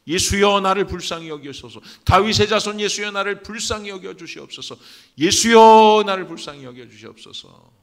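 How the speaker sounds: high, loud cry for mercy; shouted plea. Shown as a demonstration. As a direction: call out at full voice, then let each phrase fall. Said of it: "여러분, 소리치세요. 크게 소리치요". (크게 부르짖는 간절한 외침)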